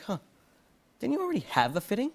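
quick, high, doubtful sister voice; skeptical sister. Retold or imitated. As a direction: rattle it off like a suspicious sibling. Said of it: "my sister's like".

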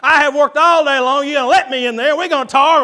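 loud, high, pounding-the-door demand; hammed-up angry shout; mock angry husband. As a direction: bellow it like a fed-up husband at a locked door. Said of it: "start pounding on the door".

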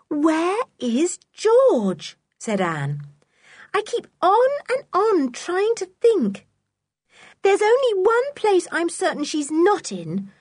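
put-on high, worried girl's voice; young girl voice. A narrator's reading. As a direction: Anne fretting, thinking aloud. Anne voiced in story.

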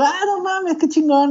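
agudo, asombrado por los trucos; emocionado. Retold or imitated. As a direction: asombro al ver a los skaters. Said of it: "Y yo". (emoción aguda)

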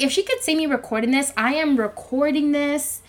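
ordinary delivery

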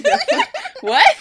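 shocked disbelief. Retold or imitated. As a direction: squeal out the shock. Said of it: "I was like".

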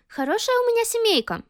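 wry thought. A narrator's reading. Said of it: "подумала девочка".

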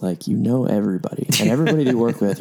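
ordinary talk

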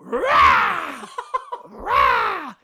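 loud high screeching croak; creepy vulture croak. Shown as a demonstration. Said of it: "can you demonstrate".

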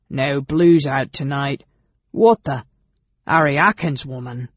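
loud goblin challenge; suspicious goblin. A narrator's reading. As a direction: question the intruders as a rough goblin guard. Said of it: "the goblin demanded suspiciously".

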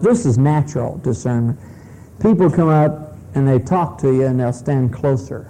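ordinary talk

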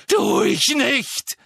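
high, loud, slow throat-tearing scream. A narrator's reading. Harry screams denial at Dumbledore. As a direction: scream it fit to tear the throat. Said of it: "schrie Harry so laut".

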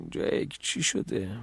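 slow, drowsy, half-whispered; just woken, barely awake; sleepy. A narrator's reading. Dad woken up asks his son, sleepy murmur. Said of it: "بابا خوابالود گفت".

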